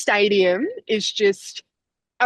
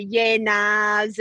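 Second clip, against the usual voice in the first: Aussie slang. Shown as a demonstration. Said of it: "bit of a slang to when we say things".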